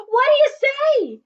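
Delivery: high, loud cheering question; the crowd cheers Kevin on to join. Acted. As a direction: cheer it up at him. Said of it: "the crowd all cheered".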